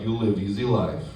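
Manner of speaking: put-on low Russian accent, grumbling softly; Russian coworker. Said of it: "I work with a guy from Russia".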